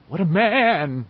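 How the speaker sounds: hammed-up worship of a hero; mock awestruck. A narrator's reading. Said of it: "in a trembling, awestruck voice".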